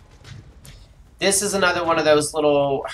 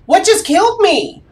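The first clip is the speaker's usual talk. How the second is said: high, loud disbelief; shocked outrage; 14 semitones higher, 6 dB louder than usual. Real, not acted. Player just killed by something unseen, shocked.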